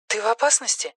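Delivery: very high, soft question; worried phone voice. Acted. Simone asks Erik about danger, high worried phone voice. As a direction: ask high and softly down the line. Симона встревожена, по телефону.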